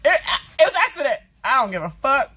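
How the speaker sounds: mock panicked woman begging; pleading quote. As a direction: plead it was an accident. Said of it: "She was like".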